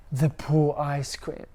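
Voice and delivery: ordinary delivery, nothing marked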